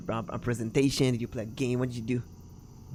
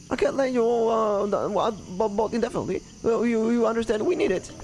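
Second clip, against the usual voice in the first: high, halting protest; flustered objector; 9 semitones higher than usual. Acted. Owner refusing to lend the boat, flustered. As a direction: stumble through the refusal in a raised voice.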